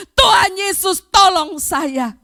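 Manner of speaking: loud pleading call; crying out to God. Shown as a demonstration. Preacher models the cry to Jesus, loud plea. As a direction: show them how to cry for help. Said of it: "untuk teriak kepada Tuhan".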